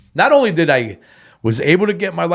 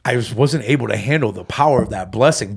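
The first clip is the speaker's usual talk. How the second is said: ordinary delivery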